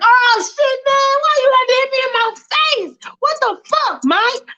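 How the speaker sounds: whining hurt guy. Acted. Punched debtor whining at Michael, very high. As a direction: whimper about the punch. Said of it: "And the guy's like".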